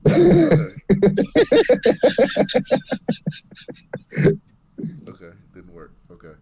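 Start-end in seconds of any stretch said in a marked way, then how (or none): 0.1-4.4 laughing. Real, not acted. Laughs after joke failed.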